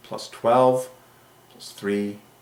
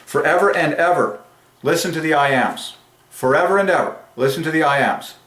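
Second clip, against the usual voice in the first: higher, punchy epic proclamation; 5 semitones higher than usual. Real, not acted. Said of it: "I have to be epic".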